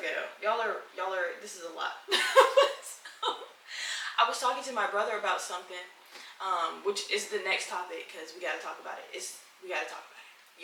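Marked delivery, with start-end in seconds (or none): 2.1-3.2 high airy burst of laughter; breathy laugh. Real, not acted. Laughing at the chaos. 9.1-10.6 "gotta" whispered, much softer, unvoiced. Real, not acted. Repeats the line.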